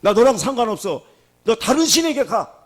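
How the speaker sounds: high, loud brush-off; God turning someone away, high and loud; stern dismissal. Retold or imitated. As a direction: push them away, sharp and loud. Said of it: "하나님이".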